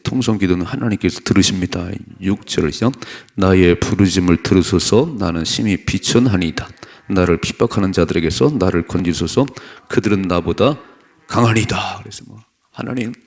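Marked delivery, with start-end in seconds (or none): 0.0-12.0 loud, declarative preaching; forceful scripture reading. Real, not acted. Preacher reads the psalm on fear, forceful. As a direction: read it out firmly to the congregation.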